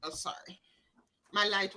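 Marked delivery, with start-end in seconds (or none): 0.0-0.6 whispered apology. Real, not acted. Host noticing her light is off, whispering sorry. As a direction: drop to a whisper.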